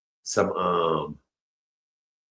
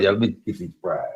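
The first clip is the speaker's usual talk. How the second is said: ordinary delivery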